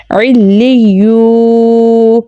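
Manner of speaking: علی یو with a long, held oo; stretched madd. Shown as a demonstration. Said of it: "ہم اسے کھینچ کر پڑھیں گے".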